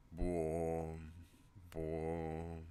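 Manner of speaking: long, loud, level vocal boom; imitated trailer boom. Vlogger making his own trailer sound effects, sustained boom.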